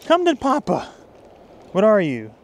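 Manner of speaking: high-pitched thrill at a bite; excited whoop. Real, not acted. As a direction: holler to the fish as it bites. Angler hooks another fish.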